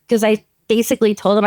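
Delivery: ordinary delivery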